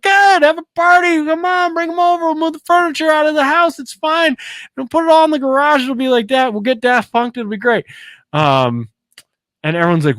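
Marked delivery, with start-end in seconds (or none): 0.0-7.9 overly happy Carl. Retold or imitated. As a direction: push the pitch way up like a giddy dad. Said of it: "And he's like".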